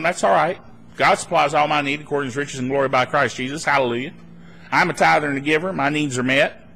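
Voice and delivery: low, quick recitation of faith; calm confidence. Acted. Believer standing firm before worried family, low calm confidence. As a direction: rattle it off, lightly voiced and untroubled. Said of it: "you're standing there going".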